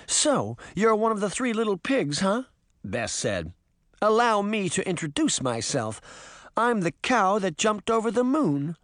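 confident storybook cow lady; Bess figure, proud and teasing. Acted.

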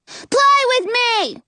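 very high, drawn-out squeal; squeaky, insistent kid; demanding little boy. A narrator's reading. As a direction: demand it like an impatient small child.

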